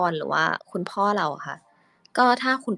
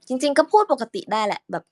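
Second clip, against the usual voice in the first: normal higher voice; 5 semitones higher, 4 dB louder than usual. Shown as a demonstration.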